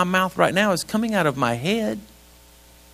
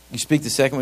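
ordinary delivery

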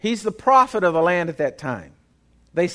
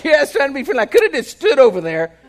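deflated, louder, higher mock protest; mock exasperated; 7 semitones higher, 7 dB louder, faster than usual. Pastor acting out his answer to the Louisiana man, mock exasperated. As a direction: throw up your hands at yourself. Retells deflated elevator reply.

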